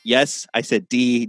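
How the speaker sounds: normal talking voice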